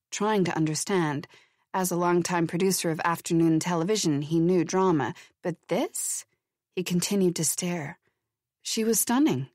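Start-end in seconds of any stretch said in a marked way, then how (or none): none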